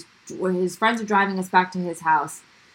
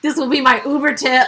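cheeky retold quip; 6 semitones higher, 6 dB louder than usual. Retelling cheeky quip at strip club.

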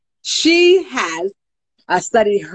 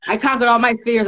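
usual speaking voice

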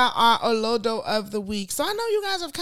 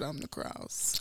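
whispered; 9 semitones lower, 12 dB quieter, slower than usual. Real, not acted. Aside, drops to nothing.

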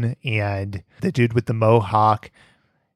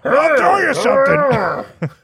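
cartoon voice; 13 semitones higher, 4 dB louder, slower than usual. Imitating cartoon voice actor.

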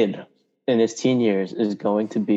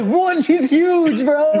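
high-pitched blurting through laughter; laughing mock shock; much slower than usual. Podcaster mocks his friend's careful wording, laughing in mock shock.

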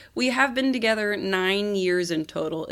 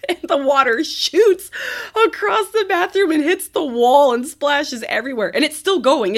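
excited; 7 semitones higher, 6 dB louder than usual. Real, not acted. Excited retelling of the spray.

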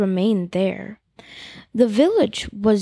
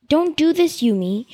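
higher plea; 6 semitones higher than usual. Acted. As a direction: press the plea up a notch. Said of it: "he told her".

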